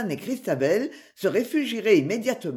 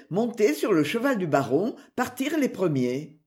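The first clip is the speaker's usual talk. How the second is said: usual speaking voice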